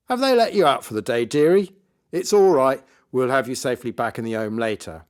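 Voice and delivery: loud, high mock concern, easing at the end; patronising magician. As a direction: talk down to her as if she were dotty.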